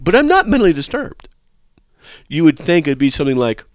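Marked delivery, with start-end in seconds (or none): 0.0-1.3 high, loud mock of the caller's logic; sarcastic parody of the caller. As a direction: squeak it out in disbelief.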